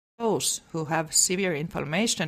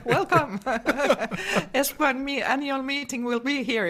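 laughing; 8 semitones higher, 3 dB louder than usual. Real, not acted. Laughs at the joke.

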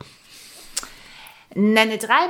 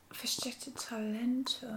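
slow, breathy whisper to herself; 18 dB quieter, slower than usual. Real, not acted. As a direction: whisper it slowly while thinking. Mulls over the question.

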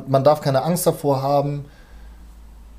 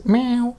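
high cat meow sound effect; 12 semitones higher than usual. Shown as a demonstration. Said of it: "sowas muss halt einfach rein".

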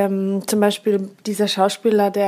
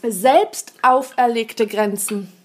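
ordinary talk, nothing marked

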